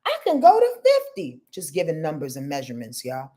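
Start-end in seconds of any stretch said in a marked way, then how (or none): 0.1-1.4 high excited realization. Pretend. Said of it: "the human says".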